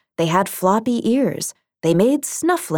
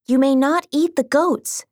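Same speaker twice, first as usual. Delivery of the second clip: cartoon princess; 7 semitones higher than usual. A narrator's reading. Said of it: "she said".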